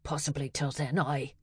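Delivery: a quiet mock Scottish brogue; Sean Connery accent. Lab assistant keeping up his Scottish accent for Nala. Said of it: "keep the Sean Connery accent going".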